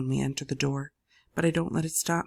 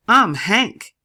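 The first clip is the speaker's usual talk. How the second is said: much louder, higher hearty voice; booming greeting; 6 semitones higher, 12 dB louder than usual. A narrator's reading. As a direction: bellow it out cheerfully. Hank booms his name.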